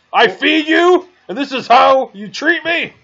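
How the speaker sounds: high, loud, indignant squawk; indignant NPC. Acted. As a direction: squawk out the hurt like a wronged host. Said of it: "He says".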